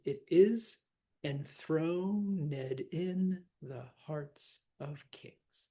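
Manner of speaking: slow verse with "enthroned" as en-THRO-ned; added ED syllable. Shown as a demonstration. Teacher scanning the line, "enthroned" in three syllables, fading on the end. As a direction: sound the ed of "enthroned" as its own syllable, slowly. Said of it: "So in this case, it would be".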